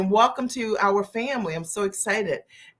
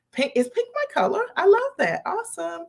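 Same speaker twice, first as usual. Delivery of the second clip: delighted squeal; 9 semitones higher than usual. Real, not acted. Viewer compliments her look.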